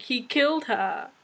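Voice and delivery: ordinary delivery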